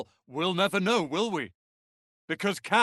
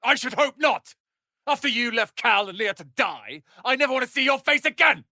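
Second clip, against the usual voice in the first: angry outburst; 6 semitones higher, 4 dB louder than usual. Acted. Companion berates for abandoning friends.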